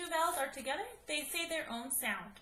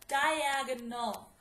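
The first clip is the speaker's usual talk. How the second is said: Diagonal said slowly, each syllable clear; slowed for spelling; 3 dB louder, slower than usual. Shown as a demonstration. Teacher to students spelling it out, Diagonal said slowly. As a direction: stretch out Diagonal so the class can hear every part. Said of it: "So let's write it".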